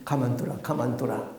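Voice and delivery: soft, low royal order to stand down; calm restraint. Acted. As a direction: quietly call off the guards, low. King calmly stops his guards. (낮고 조용하게 말리는 말투)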